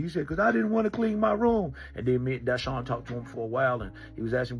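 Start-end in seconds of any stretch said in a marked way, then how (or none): 0.3-1.7 a lightly voiced kid, a bit higher; a hint of a sulking boy; mildly raised child's voice; runaway boy. Boy's reason, voiced by teller.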